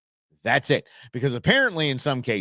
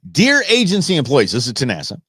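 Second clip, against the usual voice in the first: loud, raised official read; formal letter reading; 4 semitones higher, 4 dB louder than usual. Real, not acted. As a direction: read it out like a memo. Reads official letter loudly.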